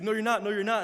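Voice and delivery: high, loud insistent denial; frantic denial. Retold or imitated. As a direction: refuse to believe it. Friend denying he's hit.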